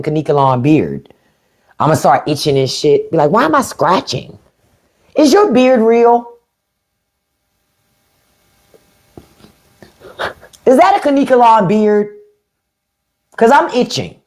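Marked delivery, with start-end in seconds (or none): 3.3-14.2 high, whiny itchy questioning; mock itchy complaint. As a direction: complain like the beard makes you itch. Imitating herself itching near beard.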